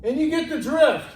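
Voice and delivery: normal talking voice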